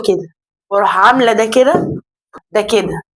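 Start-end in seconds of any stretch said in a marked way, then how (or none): none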